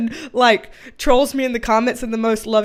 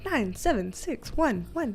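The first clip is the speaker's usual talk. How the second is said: phone voice; 8 dB quieter than usual. Shown as a demonstration. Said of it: "that is like a phone".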